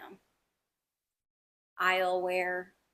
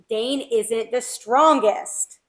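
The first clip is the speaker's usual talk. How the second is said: slow, loud, drawn-out insistence; mock scream; 8 dB louder, much slower than usual. Andarna yelling at Violet, slow and loud. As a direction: belt each word slowly.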